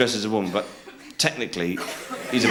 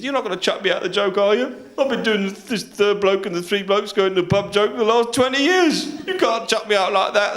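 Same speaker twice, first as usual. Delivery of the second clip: high-pitched, mounting indignation; hammed-up affronted complaint; outraged protest; 8 semitones higher than usual. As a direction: protest the sacking, climbing to a peak. Bloke protests being sacked.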